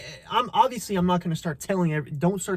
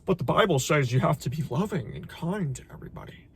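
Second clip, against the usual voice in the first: a touch of whiny mimicry of commenters; lightly voiced preachy critic; mocking objection; 3 semitones lower, 3 dB quieter than usual. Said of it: "be like".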